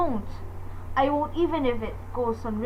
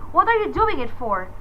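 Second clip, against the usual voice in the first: ordinary talk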